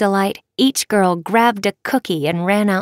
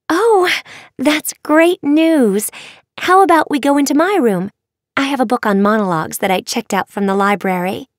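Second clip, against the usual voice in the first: put-on high, eager young girl; bubbly schoolgirl gushing quickly; excited girl voice; 6 semitones higher, 3 dB louder than usual. Eager girl Faybelle invites.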